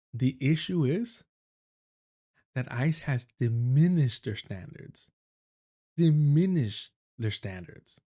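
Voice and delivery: slow mocking disbelief; sarcastic, slow. Real, not acted. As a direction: draw it out sarcastically. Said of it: "This is where we're at".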